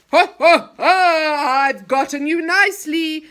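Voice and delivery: loud, high mocking laugh; gloating villain laugh. Enchanter gloating over the trapped children, loud laugh. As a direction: cackle in triumph.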